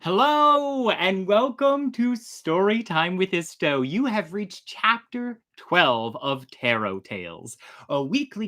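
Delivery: bright, upbeat host greeting, slowed down; show host welcome. Acted. Host greeting viewers at the start of Story Time, upbeat. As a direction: welcome the audience like a showman.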